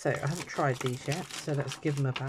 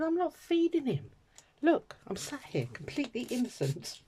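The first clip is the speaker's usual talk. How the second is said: high, sing-song fake innocence; mock innocent protest; 10 semitones higher than usual. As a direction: play the wide-eyed innocent. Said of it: "I said".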